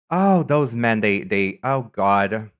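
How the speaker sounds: ordinary talk, nothing marked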